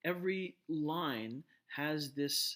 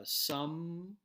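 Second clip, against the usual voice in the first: "some" held out higher as the strong beat; stressed syllable; 6 semitones higher, 5 dB louder than usual. Shown as a demonstration. Lecturer marking summer's first syllable, stressed and raised. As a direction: lift and lengthen "some". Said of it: "the sum is stressed".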